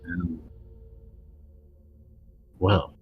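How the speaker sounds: slow, hushed storyteller voice; scene setting. Acted. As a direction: describe it slowly with awe. Game master describes crowd.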